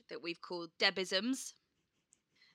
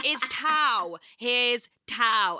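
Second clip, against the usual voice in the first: loud, insistent Cockney mum impression; mum's Cockney; 3 semitones higher, 9 dB louder, slower than usual. Mum repeats it to her baffled daughter, imitated Cockney. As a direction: bellow "towel" with no L, drawn out. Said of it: "she can't pronounce the letter L".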